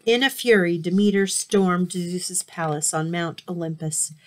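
ordinary delivery, nothing marked

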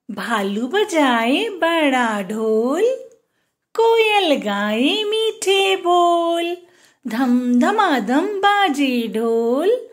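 slow, sing-song rhyme; poem recital. Acted. Teacher reciting a rhyme to young kids, slow. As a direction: recite it slowly, line by line.

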